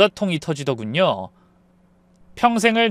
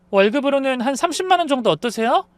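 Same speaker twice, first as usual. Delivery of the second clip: higher-pitched, casual offer with a questioning lift; lightly voiced daughter-in-law; 8 semitones higher than usual. A narrator's reading. Daughter-in-law proposes pay to mother-in-law, lightly coaxing.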